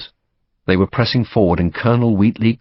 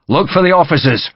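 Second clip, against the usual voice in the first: raised, higher call to the men; officer's order; 7 semitones higher, 3 dB louder than usual. A narrator's reading. Officer to his men in a firefight, calling out.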